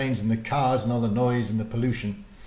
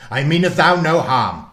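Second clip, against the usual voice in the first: lightly voiced gruff old warlock, loud; a hint of a rough old man calming her; gruff man's voice; 6 semitones higher, 11 dB louder than usual. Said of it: "in a low, gruff man's voice".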